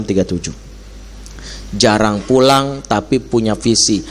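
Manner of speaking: usual speaking voice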